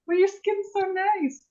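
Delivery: a hammed-up, high, gushing compliment; mock tipsy gushing. Drunk self gushing to Erica.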